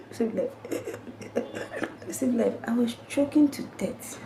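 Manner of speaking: coughing and gasping, then weak words; imitated choking fit; choking coughs. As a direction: choke and splutter before speaking. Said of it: "I was like".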